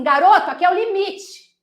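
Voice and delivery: loud, high telling-off; scolding margin. Acted. Margin warning a boy who overstepped, loud scolding. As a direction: play the page margin calling a halt. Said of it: "A margem, ela fala assim".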